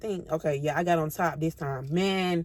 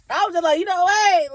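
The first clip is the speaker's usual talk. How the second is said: high, loud hyped-up exclamation; 12 semitones higher, 8 dB louder than usual. Retold or imitated. Narrator voicing her own hyped-up self. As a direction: pump yourself up.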